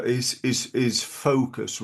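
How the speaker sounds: usual speaking voice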